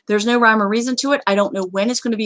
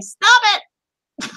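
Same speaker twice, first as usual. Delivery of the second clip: high, exasperated command; mock scolding; 10 semitones higher, 3 dB louder than usual. Host telling players to stop reporting, mock scolding. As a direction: cry out in playful outrage.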